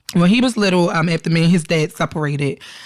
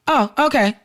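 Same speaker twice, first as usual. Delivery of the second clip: imitating a child's innocent acceptance, higher; lightly voiced little boy, pitched up; 6 semitones higher than usual. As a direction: say it straight-faced, like a trusting kid. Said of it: "Yes, innocently".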